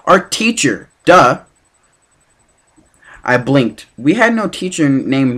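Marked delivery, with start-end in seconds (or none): 0.1-1.4 higher, louder, with a sneer on "duh"; bratty classmate rolling her eyes; snotty duh. Acted. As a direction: talk down to him like he's stupid. Bratty girl mocking Percy.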